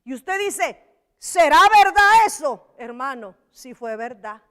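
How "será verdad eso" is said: doubting exclamation. Acted. Listener doubting, voiced loudly.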